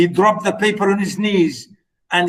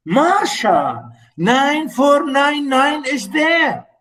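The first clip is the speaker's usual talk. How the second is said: stunned, slow and high; hollow stunned reading of the line; 8 semitones higher, slower than usual. Acted. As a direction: say it like the floor just dropped away. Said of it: "he said in a hollow, in a hollow voice".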